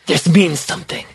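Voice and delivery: mock-intense dramatic quote. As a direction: lightly imitate a man's grave intensity. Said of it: "He's very intense".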